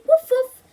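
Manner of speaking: high, loud yapping woof woof; puppy barks. Acted. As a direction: bark like a playful puppy.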